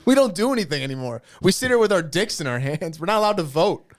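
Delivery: high, laughing complaining senator; whiny senator. Pretend. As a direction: whine like a powerless Roman senator. Plays complaining senators in spoof.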